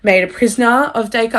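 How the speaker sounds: ordinary delivery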